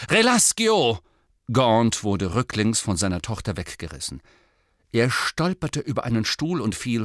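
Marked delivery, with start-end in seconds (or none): 0.1-1.0 loud spell shout; calling out. A narrator's reading. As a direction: cast it with a loud cry.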